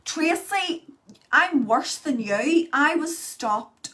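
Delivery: loud, high, slow excited reply; loud high excitement at a viewer comment. Real, not acted. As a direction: eagerly tell a viewer she's worse.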